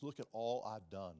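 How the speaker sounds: bragging religious man; boastful self-righteous voice. Retold or imitated. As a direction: proudly list your deeds. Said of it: "bring their spiritual resume to Jesus".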